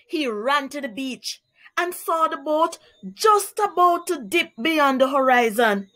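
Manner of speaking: usual speaking voice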